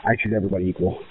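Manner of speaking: normal talking voice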